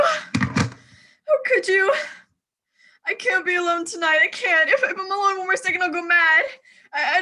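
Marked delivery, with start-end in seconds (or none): 0.0-1.9 high, very slow, sobbing plea; the question dissolves into crying; a desperate tearful wail to her absent husband; breaking into sobs. Acted. Wife abandoned, alone and distraught. 4.7-6.4 frantic, near-tearful cry; she's on the edge of hysteria; a cracking, fearful outburst; panicked outcry. Acted. Alone, panicking at night.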